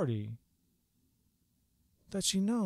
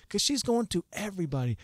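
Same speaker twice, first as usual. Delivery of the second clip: ordinary delivery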